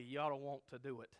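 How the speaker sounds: usual speaking voice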